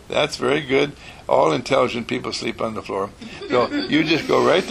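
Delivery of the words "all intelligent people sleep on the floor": ordinary delivery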